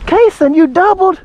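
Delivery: high-pitched, thrilled disbelief; amazed excitement. Real, not acted. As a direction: ask it like you can hardly believe it. Father amazed at child's shot.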